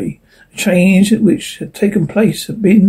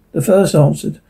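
plain reading voice

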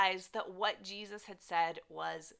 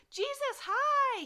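much higher, bright overfriendly hello; fake welcoming greeting; 7 dB louder, slower than usual. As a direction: greet him like a long-lost friend, much higher. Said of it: "he's acting all welcoming".

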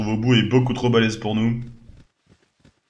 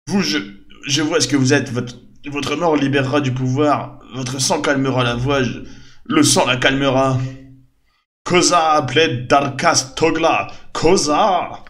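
higher, louder possessed chant; slow ominous chant, calling on Koza; prophetic trance voice; 4 semitones higher, 5 dB louder, slower than usual. Acted. Possessed worker threatens, chants Koza.

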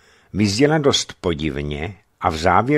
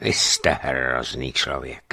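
low, slow and soft contempt; icy contempt; 7 semitones lower, 4 dB quieter, slower than usual. A narrator's reading. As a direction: say it with cold disgust. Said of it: "tím nejchladnějším odporem".

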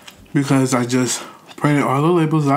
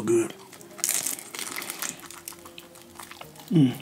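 chewing while talking; muffled by food; mouth full; 4 semitones lower, 12 dB quieter than usual. Real, not acted. Seen 0: Bites fried roll at 0.6s, chews with hand at mouth.

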